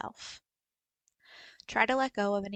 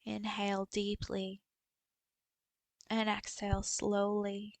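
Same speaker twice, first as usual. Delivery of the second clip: guided meditation. Acted. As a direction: guide them gently through a body scan, unhurried. Leads a body scan meditation.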